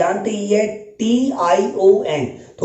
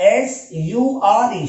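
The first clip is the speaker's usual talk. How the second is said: एस यू आर ई spelled very slowly, each letter apart; much slower than usual. Shown as a demonstration. Teacher slowly spelling एस यू आर ई for students. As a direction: drag out every letter. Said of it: "sure की spelling तो आती है".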